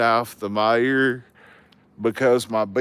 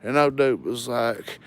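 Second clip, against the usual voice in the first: ordinary talk, nothing marked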